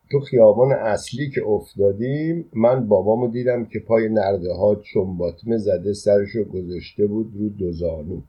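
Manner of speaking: plain reading voice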